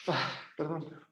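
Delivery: breathless, soft apology; short of breath mid-sentence. Real, not acted. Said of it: "hay momentos que me falta aire".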